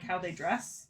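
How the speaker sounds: usual speaking voice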